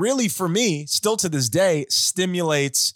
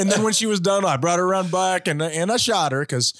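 put-on Southern accent; 5 semitones higher than usual. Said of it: "It's not a terrible Southern accent".

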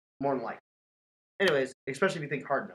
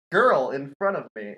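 usual speaking voice

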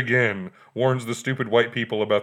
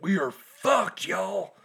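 high, slow drawn-out dread; panicked kid; 10 semitones higher, much slower than usual. Retold or imitated. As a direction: squeak out the doom. Kid's reaction voiced.

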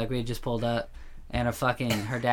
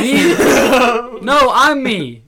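silly voice; 13 semitones higher, 12 dB louder, much slower than usual. Pretend. Silly identity bit, squeaky voice.

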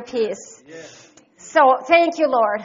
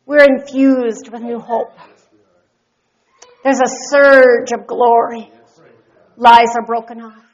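prophetic declaration; 4 semitones lower, 5 dB louder than usual. Real, not acted. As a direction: declare it over them.

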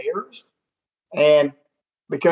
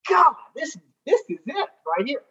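high, then hushed certainty; thrilled realization; 8 semitones higher than usual. Retold or imitated. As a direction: quote your own eureka moment. Inner realization quoted.

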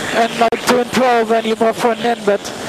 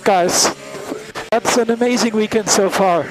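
ordinary talk, nothing marked

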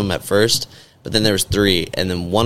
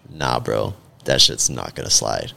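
a lower, firmer take on a friend; imitating Isaac; 9 semitones lower than usual. Friend refusing to let the store get away with it, in a lower imitated voice. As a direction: drop the voice down to play Isaac.

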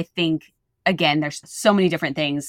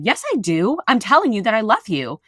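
insistent mom; 7 semitones higher, 5 dB louder than usual. Pretend.

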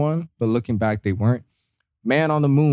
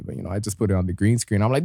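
ordinary delivery

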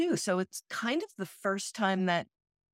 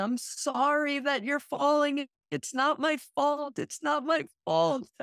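put-on apologizing toddler; 10 semitones higher than usual. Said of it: "she was apologizing".

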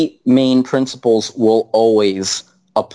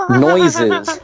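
high monkey hoots; chimp hooting. Retold or imitated. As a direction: hoot it like a chimp. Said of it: "Wow, that was really good".

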